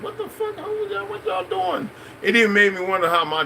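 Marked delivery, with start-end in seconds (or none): 0.0-1.9 squeaky, much higher outburst; a high falsetto gasp of disbelief at the coffee; shocked disbelief. Retold or imitated. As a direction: squeal it like you can't believe your ears. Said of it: "I was like".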